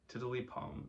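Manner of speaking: plain reading voice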